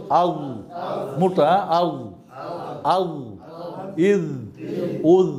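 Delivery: slow, separate syllables for students to repeat; Arabic sound drill. Shown as a demonstration. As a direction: clear drill syllables, one by one. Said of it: "drills sounds for students".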